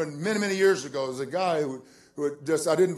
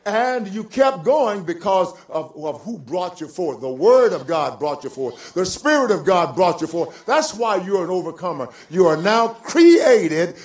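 loud, building pulpit cadence; full-force preaching; 6 semitones higher, 11 dB louder, slower than usual. Acted. Preacher telling believers who brought them forth, full-force preaching. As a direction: hammer each phrase, stretching the key words.

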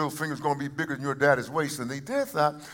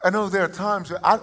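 ordinary talk

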